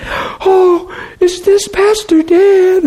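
high, slow, crying woman's voice; tearful caller. Retold or imitated. Caller in tears asks the pastor if it is him, high slow crying voice. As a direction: imitate a sobbing woman on the phone. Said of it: "'cause he sounded a little scary".

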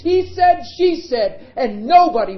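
high, loud preacher's climax; full-throated sermon peak; preaching at full force. Acted. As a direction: hammer the point home to the congregation.

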